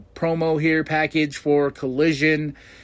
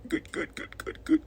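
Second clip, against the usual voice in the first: gleeful whisper; 14 semitones higher, 11 dB quieter than usual. Real, not acted. Gleeful anticipation of the match.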